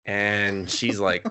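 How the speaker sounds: usual speaking voice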